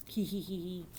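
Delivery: soft, sly villain's titter; understated evil "hee hee"; evil laugh. A narrator's reading. Dinosaur plotting against a little girl, soft evil laugh. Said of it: "sounded like a big evil laugh".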